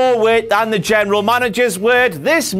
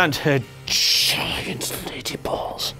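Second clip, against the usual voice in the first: soft unvoiced whisper; 6 semitones lower, 8 dB quieter, slower than usual. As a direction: whisper it as an aside. Whispered aside.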